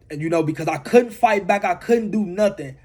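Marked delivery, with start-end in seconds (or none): none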